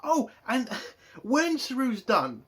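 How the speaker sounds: ordinary talk, nothing marked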